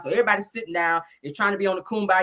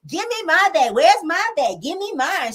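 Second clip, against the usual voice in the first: high-pitched mock insistence; acted demanding; 8 semitones higher, 3 dB louder, slower than usual. Kimmy dancing for a gift bag, high mock demanding. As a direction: imitate someone clamoring for their share, pitched way up.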